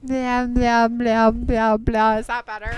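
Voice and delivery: booming mic check; right in your ear. Real, not acted. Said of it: "put it closer to my mouth".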